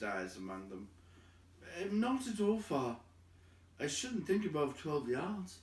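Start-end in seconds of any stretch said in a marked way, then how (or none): 1.8-5.6 high, light hobbit voice; light and high, quick to answer; Bilbo's voice. Acted.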